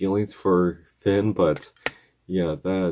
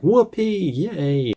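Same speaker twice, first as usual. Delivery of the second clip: gleeful cheer; 9 semitones higher, much slower than usual. Retold or imitated. Old wizard cheering that the hero is back, giddy high squeal. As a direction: whoop it up like a giddy old man. Simon Petrikov cheering in recap.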